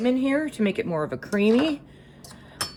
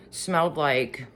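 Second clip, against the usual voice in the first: usual speaking voice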